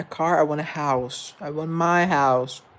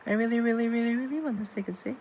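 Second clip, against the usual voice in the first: high pleading wish; 8 semitones higher, 5 dB quieter, much faster than usual. Pretend.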